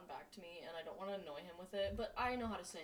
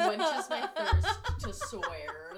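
squealing with laughter through words; laughing; 9 semitones higher, 13 dB louder, much slower than usual. Real, not acted. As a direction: laugh it out high and slow. Laughing at a swearing joke.

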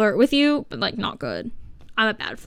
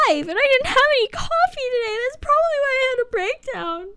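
mock dramatic whine; 14 semitones higher, faster than usual. Dramatic coffee-deprived complaint.

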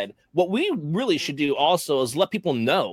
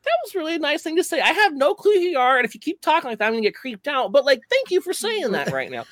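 high, sweet awkward thank-you; mock polite reply. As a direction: thank them sweetly, slightly creeped out. Imitating polite creeped reply.

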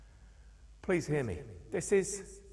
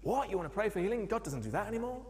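higher, incredulous rising question; mock horror at the idea; shocked objection; 8 semitones higher, faster than usual. Pastor refusing healing prayer, incredulous and high.

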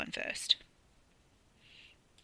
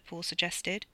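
plain reading voice

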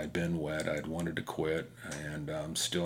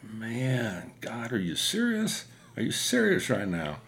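raised, louder voice of disbelief; half-shouting at God, fed up; exasperated outburst in the helmet; 5 semitones higher, 4 dB louder, slower than usual. Retold or imitated. Rider exasperated at God in the storm, calling out louder. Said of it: "I'm literally talking like this in my helmet".

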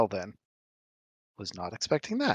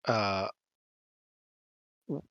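ordinary delivery

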